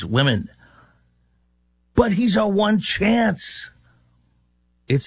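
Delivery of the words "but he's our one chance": high, drawn-out mocking plea; cling to the one chance, slowly; mock desperate pleading. Mocking Democrats' blind hope.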